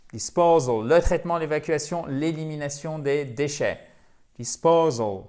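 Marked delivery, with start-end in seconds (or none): 0.1-0.9 DISPOSAL with PO punched louder; accent tonique. Shown as a demonstration. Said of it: "Accent tonique". 4.4-5.2 DISPOSAL with PO punched louder; accent tonique. Shown as a demonstration. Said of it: "bien faire cet accent tonique".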